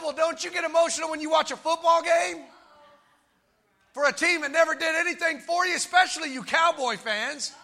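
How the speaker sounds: full-voiced, emphatic preacher; fervent preaching. Real, not acted. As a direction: drive each phrase home to the congregation.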